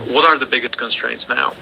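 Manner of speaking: ordinary talk, nothing marked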